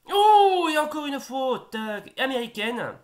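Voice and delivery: high loud burst at a foul; exclaim, caught off guard; surprised outcry. Real, not acted. Foul in the match.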